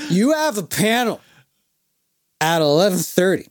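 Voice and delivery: emphatic imitated drunk reminder; drunk scolding. Drunk fan reminding a panelist of tomorrow, mock-drunk scolding. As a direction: lecture like a drunk, broken into chunks. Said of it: "X amount of drinks".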